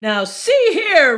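slow, loud outraged bear roar. Acted. Papa Bear confronting Goldilocks in bed, loud roar. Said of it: "roared Papa Bear".

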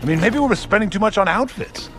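high-pitched, worked-up tirade; exasperated rant. Acted. Warlord berates generals over losing to ninjas, exasperated rant. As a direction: rant at them, voice pitched right up.

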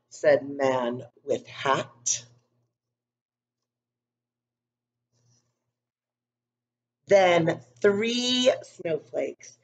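put-on calm storybook narration for young kids; story reading. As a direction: read it gently to a class of children. Reads picture book to class.

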